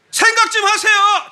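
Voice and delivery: high, drawn-out yell at the congregation; sudden shout. Acted. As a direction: burst out loudly, stretching the words. Preacher suddenly shouts the command. (갑자기 높고 크게 외치기)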